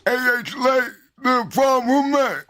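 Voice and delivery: put-on eager young lawyer; young Phoenix Wright. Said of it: "It's like a young Phoenix Wright".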